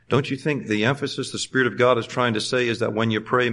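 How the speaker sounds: ordinary talk, nothing marked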